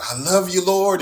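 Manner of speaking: cried out to God, high and loud; rising fervent cry of love; 1 loud praise. Pretend. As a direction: call it up to the Lord. Preacher voices crying out to God.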